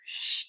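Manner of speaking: soft drawn-out shushing noise; hushed mimicked "shh"; imitated shh. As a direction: imitate the streamer's quiet shh. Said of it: "And he's like".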